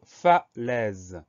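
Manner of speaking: falaise stretched slowly as fa-laise; syllable by syllable. Shown as a demonstration. Teacher dictating the last word of the list, syllable by syllable. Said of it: "Première syllabe".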